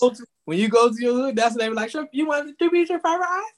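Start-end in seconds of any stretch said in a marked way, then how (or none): 1.9-3.5 much higher, quick questioning mimicry; imitating the carryout cashier. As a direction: ask it up high like the cashier behind the glass. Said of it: "That's how they talk".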